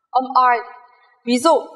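normal talking voice

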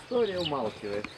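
usual speaking voice

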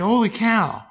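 high, loud holy cow; surprised exclaim. Retold or imitated. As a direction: gasp it out in amazement. Reaction to hitting pipe.

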